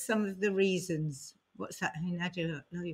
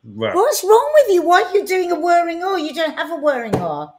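louder and higher, pretending to be appalled; mock outrage; 7 semitones higher, 6 dB louder than usual. Real, not acted. Teasing outburst at odd R.

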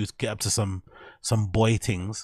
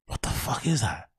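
hushed, voiceless what the fuck; whispered disbelief. Retold or imitated. Imagining Rogan's reaction, whispered in disbelief. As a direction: whisper it like a shocked viewer.

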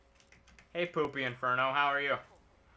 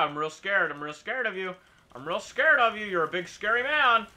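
1 sarcastic fear; 5 semitones higher, 3 dB louder than usual. Acted.

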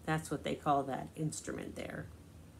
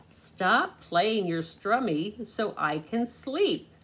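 slow, louder, fed-up demand; upset; 4 semitones higher, 6 dB louder, slower than usual. Acted. Yellow tells Red to stop playing, slow louder upset demand. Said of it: "Both friends are upset now".

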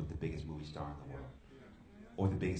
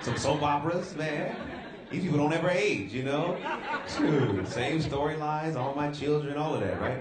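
laughing; 7 semitones higher, 7 dB louder than usual. Real, not acted. Laughing about daytime TV.

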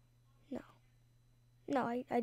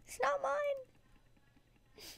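high, slow, soft whisper; mock sad whisper; 13 semitones higher, 7 dB quieter, much slower than usual. Gamer mourning the lost fruit to viewers, a high whisper. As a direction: breathe it out heartbroken.

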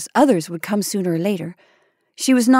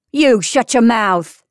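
slow, louder, fierce; angry retort; 2 semitones higher, 5 dB louder, slower than usual. A narrator's reading. Delia silences Maggie's gossip, slow angry retort. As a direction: bite it out to silence her.

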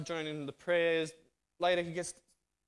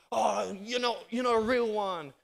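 higher and louder, sneering gang taunt; mocking taunt; 5 semitones higher, 5 dB louder than usual. Gang mates jeering at him for quitting, mocking. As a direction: jeer at him like an old gang mate.

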